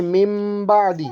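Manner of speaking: من بعدی read as "mim ba'di", the nun turned to a nasal mim, drawn out slowly; iqlab reading. Shown as a demonstration. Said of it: "من بعدی پڑھتے ہیں نا".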